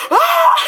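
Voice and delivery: sudden high shriek at a deer; panicked scream. Retold or imitated. Friends in car spot a deer, shrieking. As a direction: yelp in fright as the deer appears.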